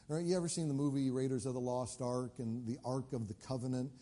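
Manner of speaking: usual speaking voice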